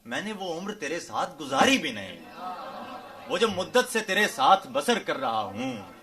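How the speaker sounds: declaimed ghazal, building on repeated lines; poetry recital. Acted. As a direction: recite each couplet with slow, swelling emphasis.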